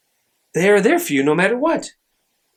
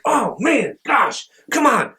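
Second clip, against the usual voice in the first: mild frustration; 7 semitones higher, slower than usual. Retold or imitated. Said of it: "Sure, I'll get frustrated".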